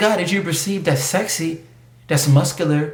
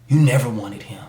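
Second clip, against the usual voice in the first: usual speaking voice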